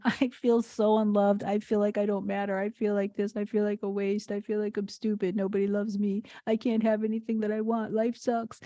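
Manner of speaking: a hint of a small child sulking and weeping; whiny crying kid. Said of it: "If I was crying at".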